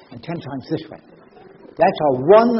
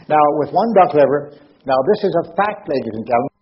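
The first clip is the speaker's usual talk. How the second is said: ordinary delivery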